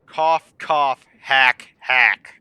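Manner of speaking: mock coughing, spoken out; fake cough. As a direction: say the cough words loudly. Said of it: "fake cough spoken as words".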